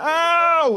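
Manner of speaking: sharp high cry of pain. Retold or imitated. Retelling hurting his hand on the bag, pained yelp.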